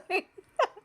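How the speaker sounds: squealing laugh near tears; overwhelmed giggle; real joy; high teary laugh. Real, not acted. Overwhelmed by visitors.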